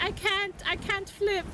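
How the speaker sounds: high cutesy voice. Retold or imitated. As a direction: squeak it like a helpless little character. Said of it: "So I was like".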